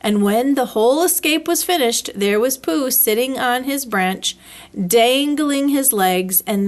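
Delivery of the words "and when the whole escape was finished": plain reading voice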